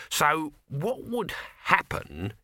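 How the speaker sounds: slow, sly question; slowly. A narrator's reading. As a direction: drag it out slyly. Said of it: "he said slowly".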